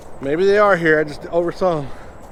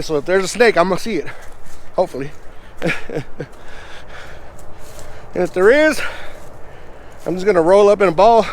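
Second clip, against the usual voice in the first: ordinary talk, nothing marked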